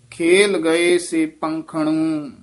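ਖੇਲ ਗਏ ਸੇ ਪੰਖਣੂ slow, with ਪੰਖਣੂ pronounced tight and firm; tight pronunciation. Shown as a demonstration. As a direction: press ਪੰਖਣੂ tightly, slowly. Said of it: "ਥੋੜ੍ਹਾ ਜਿਹਾ ਕੱਸ ਕੇ ਉਚਾਰਨ".

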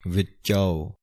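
ਵਿੱਚਾਓ said slowly, each sound clear, ending in a full "-ao"; careful pronunciation. Shown as a demonstration. Teacher showing students how to say ਵਿੱਚਾਓ, careful and clear. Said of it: "ਕਨੌੜੇ ਦੀ ਆਵਾਜ਼ ਦੇਣੀ ਹੈ".

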